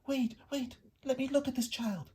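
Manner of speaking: high, urgent call. Acted. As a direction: push through urgently. Mother bat pushing through crowd.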